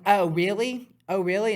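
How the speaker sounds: high mocking oh really; mocking girl. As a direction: imitate her sassy challenge. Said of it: "She's like".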